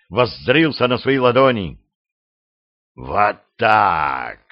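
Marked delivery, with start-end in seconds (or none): 2.9-4.4 rasping demon, low; hoarse rasp. A narrator's reading. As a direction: wheeze it out hoarsely. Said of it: "прохрипел демон тьмы".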